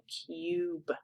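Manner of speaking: whispered "cube" with U saying its own name, yoo; soft whispered "cube", long U like "you". Shown as a demonstration. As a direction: breathe "cube", stretching the yoo. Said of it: "making that U kind of say its own name".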